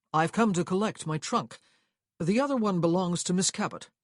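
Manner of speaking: a touch firm, stating his business; lightly voiced traveller. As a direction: lightly play a traveller at a clerk's counter. Narrator voices Rowan, a traveller.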